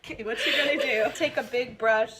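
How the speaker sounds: laughing while being tickled; giggling through the words. Real, not acted. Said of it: "She's tickling me".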